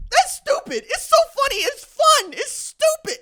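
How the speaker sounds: cracking up at how absurd it is; giddy disbelief. Real, not acted. Host delighting in transfer chaos to his audience, giddy high exclamations. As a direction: voice jumps way up, loud and delighted.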